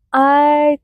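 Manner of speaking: ordinary talk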